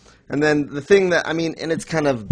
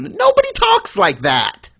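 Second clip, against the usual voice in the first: squeaky, high, incredulous outburst; exasperated; 8 dB louder than usual. Real, not acted. Ranting to co-host about awful dialogue, high incredulous squeal. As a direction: let it crack up high in disbelief.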